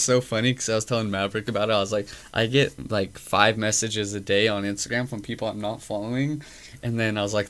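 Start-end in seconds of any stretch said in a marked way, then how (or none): none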